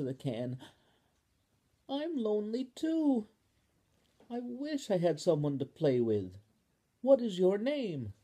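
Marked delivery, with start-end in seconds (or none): 1.9-8.1 put-on higher, sweet child voice; Spoon, lonely too. Spoon answers Ball in the can, high and hopeful. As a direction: reach out hopefully.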